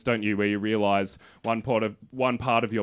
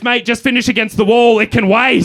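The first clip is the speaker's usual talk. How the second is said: higher, louder laughing shout of a cop; cop's shout; 9 semitones higher, 9 dB louder than usual. Retold or imitated. Policeman yelling at the peeing comedian, a loud mock shout. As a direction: bellow it across the yard like an exasperated cop. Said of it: "He goes".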